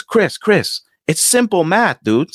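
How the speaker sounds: high, condescending salesman voice; imitated salesman. Salesman talking down to Chris, high and smug.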